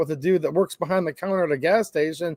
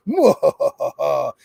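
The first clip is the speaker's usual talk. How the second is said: low, drawn-out villain laugh; mock-menacing overlord cackle; hammed-up power-mad laugh; evil laugh; 4 semitones lower than usual.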